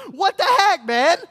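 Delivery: high, loud outraged yelp; hammed-up shout of indignation; indignant kid. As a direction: play a kid yelling in protest. Imagined kid protests lunch being snatched.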